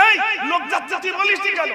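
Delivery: high, loud mock scolding; acted indignant telling-off; angry mother. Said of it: "ছেলের উপরে রেগে".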